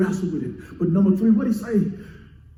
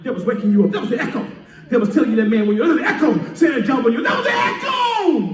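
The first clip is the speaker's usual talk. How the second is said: building preached refrain. Acted. Preacher driving home the echo, climbing to a shout.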